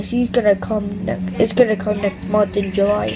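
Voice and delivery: ordinary talk, nothing marked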